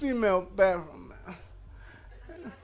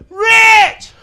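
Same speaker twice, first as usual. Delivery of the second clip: much higher and louder, a full desperate yell of the name; scream for help; 12 semitones higher, 17 dB louder than usual. Retold or imitated. Uncle screaming for his nephew at night, desperate yell. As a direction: call out across the house in pain. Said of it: "I just screamed to the top of my lungs".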